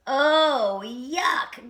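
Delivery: high, loud "oh, yuck" of disgust; disgusted groan. A narrator's reading. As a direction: groan it out, grossed out. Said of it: "groaned Christopher Church Mouse".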